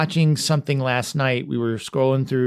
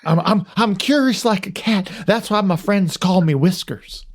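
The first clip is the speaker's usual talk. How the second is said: pitched-up goofy sportscaster voice; Will Ferrell as Harry Carey; 5 semitones higher than usual. Retold or imitated. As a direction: play a daffy announcer introducing himself. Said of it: "I wanna do Will Ferrell's version of him".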